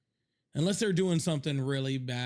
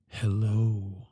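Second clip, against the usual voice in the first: low, louder vampire hello; a deep, loud Dracula greeting; 4 semitones lower, 6 dB louder than usual. Pretend. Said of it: "sounds like I'm fucking Dracula".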